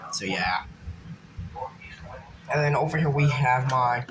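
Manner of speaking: ordinary talk, nothing marked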